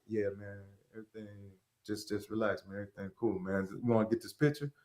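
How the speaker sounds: calm and noncommittal, low; low, cool brush-off. Retold or imitated. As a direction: stranger not recognizing him, low soft brush-off. Guy voiced calm and distant.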